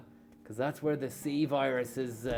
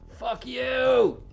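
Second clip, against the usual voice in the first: much higher, loud yell at the corner; angry yell; 10 semitones higher, 8 dB louder than usual. Real, not acted. Driver yells at the bend while taking the corner. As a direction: hurl it at the bend.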